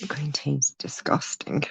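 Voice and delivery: breathy whisper, no voice; whispered aside. Real, not acted. Host whispering to viewers about green tea, whispered disgust. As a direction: whisper it like a secret complaint.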